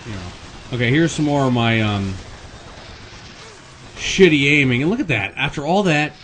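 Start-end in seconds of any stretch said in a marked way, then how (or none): none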